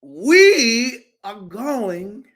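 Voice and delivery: slow, drawn-out sermon cadence; stretched pulpit delivery; preacher voice. Acted. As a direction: preach it to the congregation. I feel like preaching up in here.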